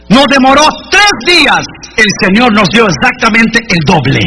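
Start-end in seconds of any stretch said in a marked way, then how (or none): none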